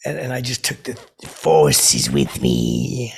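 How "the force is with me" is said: low, slow menacing Vader voice; Darth Vader. Retold or imitated. Teasing Harrison Ford again, low slow Vader voice. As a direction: drop low and drag every word. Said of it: "I said it like that again".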